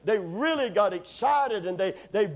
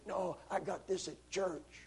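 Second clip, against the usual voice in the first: hoarse, much softer, slow, with a laugh; 11 dB quieter, slower than usual. Retold or imitated. Preacher tells the clerk, hoarse and laughing. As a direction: rasp out the punchline through a laugh. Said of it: "this voice like this".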